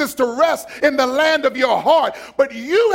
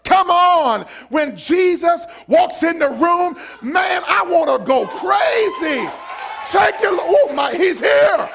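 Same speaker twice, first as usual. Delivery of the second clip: fervent preaching; 5 semitones higher, slower than usual. Real, not acted. Pastor preaching excitedly about Jesus arriving. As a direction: lift them into joy.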